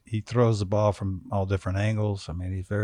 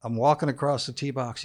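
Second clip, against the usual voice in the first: ordinary talk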